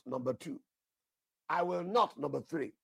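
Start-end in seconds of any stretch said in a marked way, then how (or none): 1.5-2.2 I will NOT with NOT hit high and loud; emphatic repetition. Shown as a demonstration. Preacher repeating God's promise for emphasis, punching NOT. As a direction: hammer home the word not. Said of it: "Three times, all right, for emphasis".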